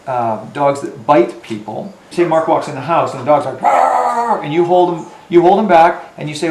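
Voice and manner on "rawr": dog growl. Retold or imitated.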